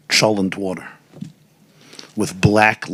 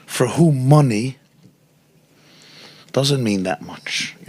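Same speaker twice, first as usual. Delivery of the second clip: usual speaking voice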